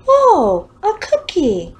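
high, loud happy surprise; a kid spotting a treat; delighted child. A narrator's reading. Christopher finds cookie.

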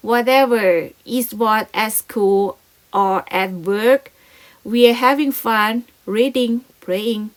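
slow, loud teacher read-aloud; slow model reading. Shown as a demonstration. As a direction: read it out slowly for the class. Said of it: "ก็คือ".

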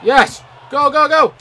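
high-pitched, loud hype; excited cheering. Real, not acted. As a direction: urge the play on, then celebrate. Cheering own play.

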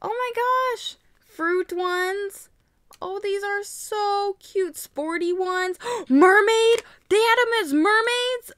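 high gushing, rising to loud delight; gush, then gasp and squeal; high-pitched real thrill; excited discovery. Real, not acted. Discovers new series on leaflet.

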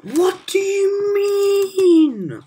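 very high, very loud, very slow scream; real rage at the game; drawn-out scream. Real, not acted. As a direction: stretch the scream out in disbelief. Raging at the game.

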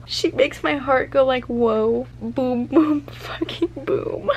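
high, slow, breaking into laughter; giddy read-out. Real, not acted. Reader quotes his confession about his heart, giddy. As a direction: savour each word, losing it with delight.

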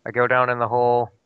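ordinary delivery

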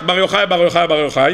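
usual speaking voice

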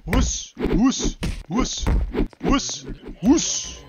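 whoosh at them like a spooky ghost; mock whoosh noises. Taunting players with high whooshing noises.

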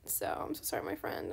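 soft, breathy whisper of sorry; whispered apology. Real, not acted.

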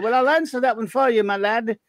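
put-on Jeeves accent. Host teases listener Max in a posh Jeeves accent. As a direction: answer like a haughty English butler. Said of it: "my annoyingly Jeeves accent".

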